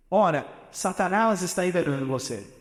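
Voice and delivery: ordinary talk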